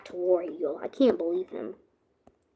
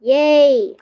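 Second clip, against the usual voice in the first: loud happy cheer; excited cheer; 5 semitones higher, 12 dB louder than usual. Real, not acted. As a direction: cheer it out triumphantly. Gets sword back.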